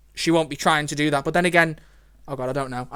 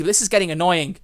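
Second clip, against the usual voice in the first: normal talking voice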